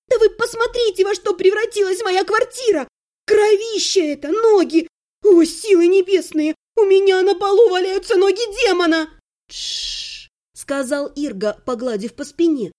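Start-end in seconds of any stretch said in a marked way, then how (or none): 0.1-9.1 high, loud outrage; indignant squealing. A narrator's reading. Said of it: "взвизгнула я". 9.5-10.3 long soft shushing; soothing hush. A narrator's reading. Husband soothes her.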